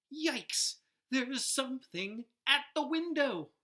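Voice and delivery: high, loud frightened cry; startled child at the window; scared. Acted. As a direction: gasp it out in fright. Frightened at night.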